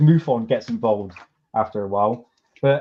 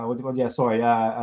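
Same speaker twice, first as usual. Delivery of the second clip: ordinary talk, nothing marked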